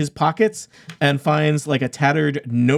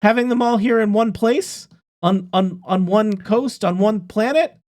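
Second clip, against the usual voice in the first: earnest NPC; 5 semitones higher than usual. Acted. NPC pleads defending his plan.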